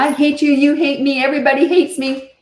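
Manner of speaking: high, loud tantrum yell, acted out; imitating a screaming kid; mock kid screaming. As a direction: shout it like a furious child. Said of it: "They're screaming".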